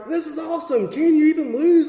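high-pitched, gleeful amazement; mock giddy confidence. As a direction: imitate a thrilled beginner on a winning streak. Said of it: "he's getting more and more confident".

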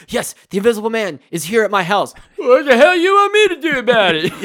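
high, loud comic phone voice; exasperated police officer. Retold or imitated. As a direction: build from report to hammed-up exasperation. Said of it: "the police officer when he gets the phone call".

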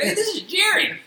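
very high, squeaky-mouse put-on voice; Jerry. Friend as cartoon mouse on a prank call, very high character voice. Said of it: "He does a good job".

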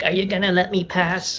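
voicing an aggressive old lady blocking the aisle; impatient old lady. Pushy old lady demanding to pass. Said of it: "they're just looking at you, it's like".

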